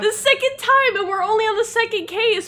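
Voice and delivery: very high, incredulous squeal; high-pitched comic outrage; exasperated disbelief. Real, not acted. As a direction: protest the unfairness in a high voice. Accused of murder a second time.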